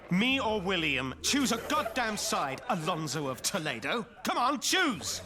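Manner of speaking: angry demand. Acted. Knight orders Alonso to choose a side, angry demand. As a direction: press hard, climbing to the last word.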